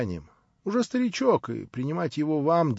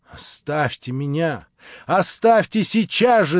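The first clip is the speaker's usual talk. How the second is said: loud, desperate shout to be left alone; 5 semitones higher, 7 dB louder, slower than usual. A narrator's reading. Natasha shouting at the prince to leave her. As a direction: cry out, rising to a scream. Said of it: "закричала она".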